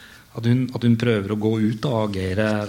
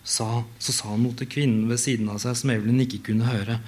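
even, measured reading of a novel; story narration. A narrator's reading. Author reading her novel at an event, measured narration. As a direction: read the passage steadily to an audience.

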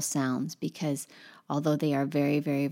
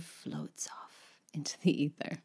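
whispered softly, floating off; 10 dB quieter than usual. Real, not acted. Teacher whispering how the vowel floats off. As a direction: breathe it out as if drifting away.

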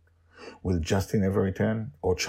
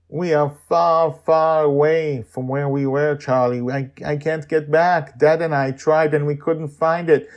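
put-on girl near tears; 8 semitones higher, 9 dB louder than usual. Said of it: "seems on the verge of tears".

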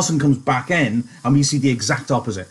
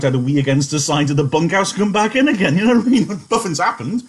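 ordinary talk, nothing marked

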